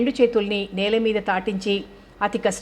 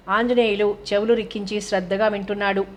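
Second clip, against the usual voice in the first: ordinary delivery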